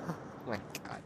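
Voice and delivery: whispered, breathless laughter; silent wheezing laugh. Real, not acted. Breathless after scare.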